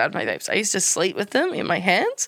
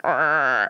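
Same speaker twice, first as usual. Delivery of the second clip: low droning vape buzz; imitated vape noise; 7 semitones lower than usual. Describing a vape used at night, low imitated buzz. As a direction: mimic a noisy vape, low. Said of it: "the vape is actually quite noisy".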